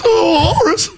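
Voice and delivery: high laughing walrus call. Pretend. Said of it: "What's the walrus sound like?".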